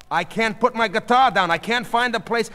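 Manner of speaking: flustered protest. A narrator's reading. Marvin holding his guitar in the crowd, flustered protest.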